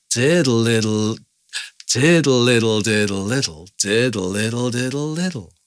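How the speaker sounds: "diddle little" leaned on strongly, then flowing on in arcs; arcing swing. Shown as a demonstration. Teacher shows different amounts of swing, arcing "diddle little". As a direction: swing "diddle", snap "little", rising in a curve before the final drop. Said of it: "I can do different amounts and create these arcs".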